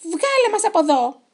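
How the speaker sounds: high, loud indignant yell; shouted demand. A narrator's reading. Trapped chicken pirates demanding release from Henry, shouting. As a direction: holler to be let out. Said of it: "φώναζαν".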